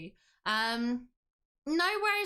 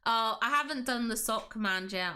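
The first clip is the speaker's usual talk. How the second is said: ordinary talk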